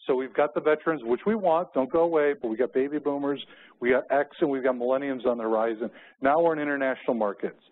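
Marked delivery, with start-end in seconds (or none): none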